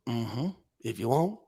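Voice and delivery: bad Southern kid impression. Kid answering a visitor asking for dad, slow mock Southern accent. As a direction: drag it out in a badly done Southern accent. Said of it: "I'm doing a bad impression of this kid".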